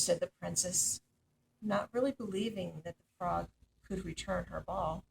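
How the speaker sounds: plain reading voice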